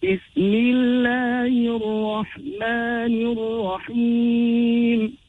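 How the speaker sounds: Bismillahir Rahmanir Rahim chanted slowly with doubled r; emphasized ra. Shown as a demonstration. Teacher showing shadda on the ra, Bismillahir Rahmanir Rahim slowly recited. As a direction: lean on each rr in Bismillahir Rahmanir Rahim, stretching the vowels. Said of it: "we read with shadda".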